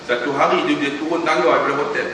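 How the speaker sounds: usual speaking voice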